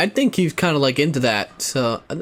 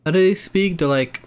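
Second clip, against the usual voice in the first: normal talking voice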